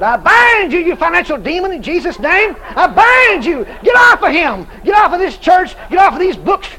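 loud, high deliverance preaching; forceful preacher rebuking a spirit; commanding prayer. Retold or imitated. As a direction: re-enact casting out a demon, punching each command. Re-enacts casting out financial demon.

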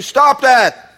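"Stop that" loud and high, firm fall; Jesus's stern command. Retold or imitated. Jesus rebuking fearful Christians, loud and firm. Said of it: "what Jesus would say to you".

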